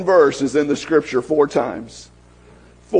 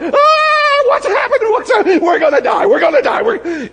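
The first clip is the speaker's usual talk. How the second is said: hammed-up panic of Elisha's servant, much higher; panicked servant; 13 semitones higher, faster than usual. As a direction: squeal it out like a terrified servant. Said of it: "servant is going crazy".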